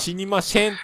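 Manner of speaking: high-pitched "死にません" said as shinimashen; Hakata "shen" ending. Retold or imitated. Imitating older Hakata speakers, "死にません" with a shen ending. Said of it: "六十代以上ぐらいの博多弁喋る人".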